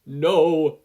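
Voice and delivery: very loud, sharp no; shouted. Acted. The vestiges shouting together at Izuku, loud. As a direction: yell it in horror. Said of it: "shouted every vestige at once".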